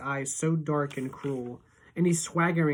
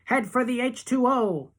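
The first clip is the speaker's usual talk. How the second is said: urgent command; 8 semitones higher, 4 dB louder than usual. A narrator's reading. Urging toward pond.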